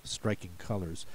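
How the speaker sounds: usual speaking voice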